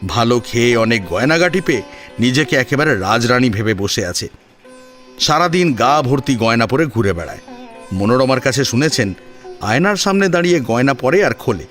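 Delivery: usual speaking voice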